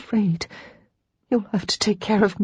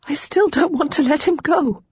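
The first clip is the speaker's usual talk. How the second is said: anguished; 5 semitones higher than usual. Acted. Lara's anguished confession.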